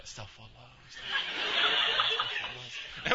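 imitating a furtive whispered prayer; whispered repenting. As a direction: whisper it under your breath, drawing it out. Homeboy mutters repentance at the counter.

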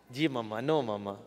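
put-on very low, soft, meek Desi accent. Kid answering mama, dutiful Desi accent. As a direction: murmur obediently in a Desi accent. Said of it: "speak English in a Desi accent".

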